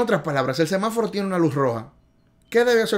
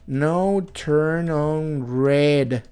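slow, careful English; much slower than usual. Shown as a demonstration. Teacher drilling a road sign for driving students, slow and careful. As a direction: spell out no turn on red slowly. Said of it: "No turn on red significa".

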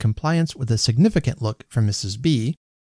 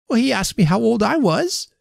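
put-on high-pitched protesting little boy; precocious child; 11 semitones higher than usual. A narrator's reading. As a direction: answer back like a cheeky child. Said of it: "said the precocious child".

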